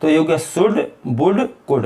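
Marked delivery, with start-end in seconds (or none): none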